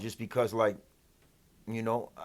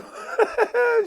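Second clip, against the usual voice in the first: high laugh. Real, not acted. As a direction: burst out cackling at how easy it was. Laughing at the girls returning.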